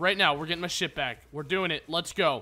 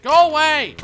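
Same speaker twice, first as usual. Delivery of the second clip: yelling; 5 semitones higher, 6 dB louder than usual. Real, not acted. Yelling at spiders.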